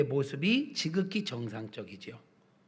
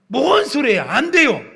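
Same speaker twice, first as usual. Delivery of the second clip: loud, high outburst; shocked refusal; 8 semitones higher, 10 dB louder than usual. Acted. Peter answering Jesus's talk of dying, loud shocked refusal. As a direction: protest sharply, voice shooting up.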